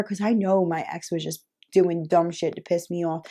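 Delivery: ordinary talk, nothing marked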